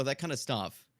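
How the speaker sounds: usual speaking voice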